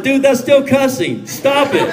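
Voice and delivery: a friend laughing while telling off another; laughing scold. Retold or imitated. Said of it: "I'm like".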